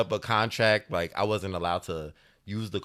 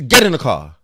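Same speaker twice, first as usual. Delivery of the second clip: louder barked command; loud stern order; 4 semitones higher, 12 dB louder than usual. Retold or imitated. Aunt ordering her nephew into the car, loud and stern.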